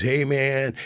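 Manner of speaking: Dennis calling out. Retold or imitated. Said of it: "he goes".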